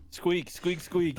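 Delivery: squeak, squeak, squeak like a squirrel; high squirrel squeaks; squirrel chatter. Retold or imitated. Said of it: "starts making squirrel noises".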